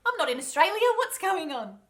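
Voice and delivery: loud, high-pitched playful protest; startled comic complaint; mock outraged surprise. Real, not acted. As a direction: exclaim it loudly and high.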